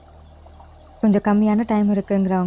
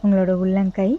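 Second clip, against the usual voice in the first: slow, even yoga nidra guidance with long pauses; guided meditation; 4 dB quieter than usual. Real, not acted. Yoga teacher guiding listeners lying down to relax each body part, guided meditation. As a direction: lead them unhurriedly into rest, phrase by phrase.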